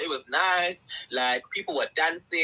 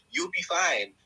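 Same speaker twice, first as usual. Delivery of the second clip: ordinary delivery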